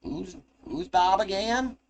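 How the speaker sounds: put-on high, slow southern-accented gnome; puzzled gnome in a southern accent; southern accent, confused. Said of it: "got a southern accent".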